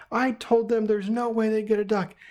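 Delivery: high-pitched imitated protest; exasperated wife. Wife explaining to husband she said no, exasperated high voice.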